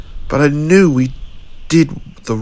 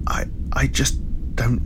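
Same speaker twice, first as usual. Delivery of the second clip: softer, whispered, faltering; broken whisper; 6 dB quieter than usual. Acted. Jason admitting to Tommy, broken whisper. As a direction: let the words fall apart.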